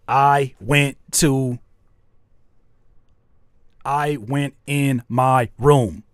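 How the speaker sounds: slow one-syllable demo line. Shown as a demonstration. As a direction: spell each word out slowly. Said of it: "those are all one syllable words".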